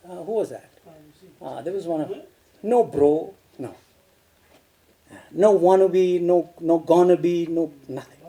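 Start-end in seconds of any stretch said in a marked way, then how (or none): 2.6-3.7 casual slang. Shown as a demonstration. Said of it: "It's a canteen language".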